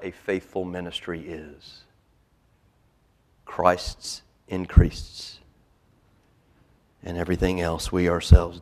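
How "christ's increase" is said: very slow, weighted words; slow, deliberate pulpit stress; slow emphasis. Real, not acted. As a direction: draw it out, each word heavy. Slow emphatic sermon phrase.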